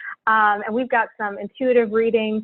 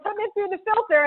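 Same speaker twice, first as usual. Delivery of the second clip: mock quote; 10 semitones higher, 5 dB quieter, faster than usual. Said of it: "I was like".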